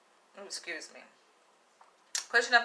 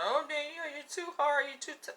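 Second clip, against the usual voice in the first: higher mock whiny commenter voice; 7 semitones higher, 7 dB quieter than usual. Mocking commenters who call her too hard, high mock voice. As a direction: mimic the critics, high and prissy. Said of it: "talking about".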